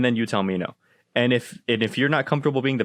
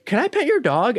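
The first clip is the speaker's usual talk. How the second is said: higher, mock-innocent question; pushy stranger; 10 semitones higher than usual. Somebody walks up to the dog owner, higher-pitched imitated request. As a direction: mimic a stranger asking to pet. Mimics stranger asking again.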